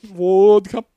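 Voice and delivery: hammed-up Russian accent, belted out; mock Russian accent. Riffing on Russian bottle rocket, mock Russian accent.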